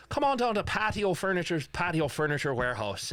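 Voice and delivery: a mock Irish ad pitch slipping into pirate talk; Irish drifting to pirate. Host demos his Irish accent turning pirate in a furniture ad. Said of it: "I try to do Irish sometimes".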